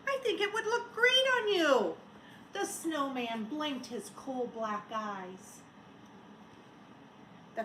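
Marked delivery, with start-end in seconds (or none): 0.1-2.0 put-on child voice. Child offers scarf.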